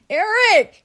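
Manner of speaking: loud high yell across house; calling out. Real, not acted. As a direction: shout his name to the next room. Yelling to him in bathroom.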